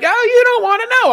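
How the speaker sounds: very high, fast, coy taunt; high sing-song mock taunt; mock high teasing girlfriend. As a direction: tease him like a tipsy girl. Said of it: "And she's like".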